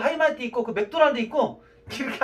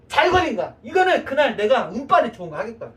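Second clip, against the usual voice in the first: usual speaking voice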